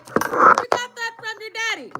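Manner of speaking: a light parent voice teasing the daughter; mock scolding parent. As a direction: throw it at her like a parent scolding.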